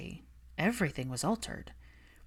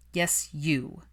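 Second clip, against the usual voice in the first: extra posh. Acted. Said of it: "made him sound extra posh".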